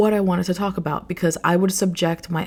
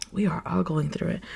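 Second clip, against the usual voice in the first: sighed, softer; 7 dB quieter than usual. Real, not acted. Repeats the reassurance after a sigh.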